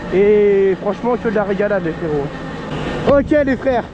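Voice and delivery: projecting over the wind; shouting over wind. Real, not acted. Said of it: "je sais pas si vous m'entendez bien avec le vent".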